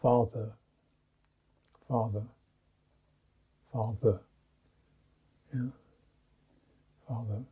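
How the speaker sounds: "father" with a soft voiced th; dh sound. Shown as a demonstration. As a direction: voice the th gently in "father". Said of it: "the sound we use for".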